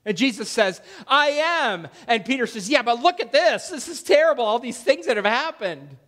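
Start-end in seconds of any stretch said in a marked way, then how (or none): 1.1-1.9 Jesus declaring. Retold or imitated. Jesus voiced, divine name. 2.7-6.0 Peter panicking. Retold or imitated.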